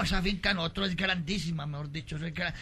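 ordinary talk, nothing marked

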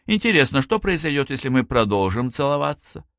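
high, airily casual wondering; feigned indifference. A narrator's reading. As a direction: wonder aloud as if it hardly mattered. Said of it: "добавил с напускным безразличием".

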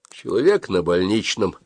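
usual speaking voice

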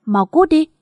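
sharp high shout to get out; angry. A narrator's reading. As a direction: throw him out, shouting. Furious son ordering friend out. (quát đuổi bạn ra khỏi nhà)

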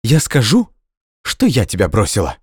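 defiant declaration. Acted. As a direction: announce it proudly. Nadya declares.

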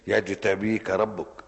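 loud, very slow recited verse; slow, loud Quran chant; emphatic recitation. Shown as a demonstration. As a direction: declaim the verse with force. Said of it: "وكذلك" إيه؟".

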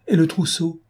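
high-pitched, protesting question; crying out. A narrator's reading. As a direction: object in a lightly acted outcry. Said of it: "cria madame Zaptine".

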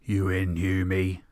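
low and keen, ready to sign on. Acted. Character eagerly agrees.

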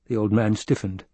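ordinary delivery

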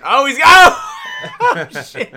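high, very loud startled yell; shocked shout. Real, not acted. Host watching wrestler get hit, shocked high shout. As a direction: burst out in real shock.

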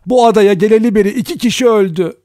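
high, loud alarmed outcry; frightened cry. A narrator's reading. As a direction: cry it out in fear and shock. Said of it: "korkuyla bağırdı herkes".

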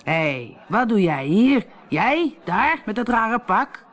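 lightly voiced pompous captain, slow; a blustering captain calling out in the inn; a hint of a pompous captain; boastful captain. Captain's voice held.

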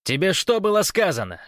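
high, sharp reprimand; irate challenge to an intruder; barked rebuke. A narrator's reading. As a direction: bark it like a guard over a loudspeaker. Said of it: "рявкнул динамик".

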